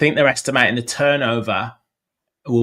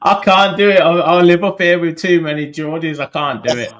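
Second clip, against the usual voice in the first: laughing protest; 5 semitones higher, 6 dB louder than usual. Real, not acted. Refusing to sing, laughing.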